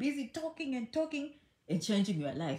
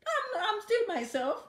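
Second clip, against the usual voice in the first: laughing protest; 10 semitones higher, slower than usual. Real, not acted. Laughing reply to imagined gossip.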